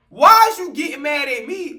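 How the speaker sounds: indignant worker snapping back at a yelling customer; indignant retort. Retold or imitated. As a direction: push back at the angry customer. Retold argument with customer.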